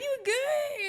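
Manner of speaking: very high, questioning squeak; high-pitched concern. Retold or imitated. Checking on an emotional friend, high concerned voice.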